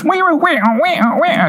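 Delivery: very high, falsetto wah wah wah; imitated warbling. Mimicking the Golden Child gold thing for his cohost, high falsetto wails. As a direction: squeak the gold thing's wailing sound. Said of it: "that gold thing that he goes".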